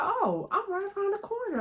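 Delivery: high, loud imitation of Kevin; Kevin's voice. Kevin answering her plea, high casual imitation. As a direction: lightly mimic Kevin's casual reply. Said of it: "He was like".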